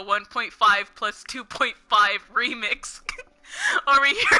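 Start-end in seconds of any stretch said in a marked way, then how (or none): 3.5-4.4 real laughter breaking through the words; helpless laugh. Real, not acted. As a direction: laugh it out in disbelief. Laughing at the bad episode.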